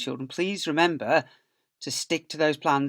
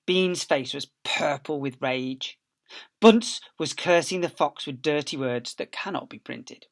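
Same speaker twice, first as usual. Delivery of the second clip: ordinary delivery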